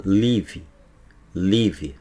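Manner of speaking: "live" said as "livi", with an added final "i"; Brazilian live. Shown as a demonstration. Teacher imitating how a Brazilian mispronounces "live". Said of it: "o brasileiro fala".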